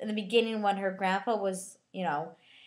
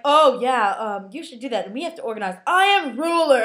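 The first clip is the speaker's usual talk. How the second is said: imitating self-important kids giving orders; mock big shot; 2 semitones higher, 7 dB louder than usual. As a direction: play a bossy little know-it-all, mockingly. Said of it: "talking like they're adults and big, big shots".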